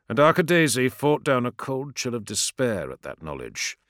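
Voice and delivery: usual speaking voice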